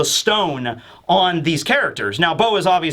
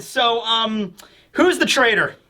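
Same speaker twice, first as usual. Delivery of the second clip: normal talking voice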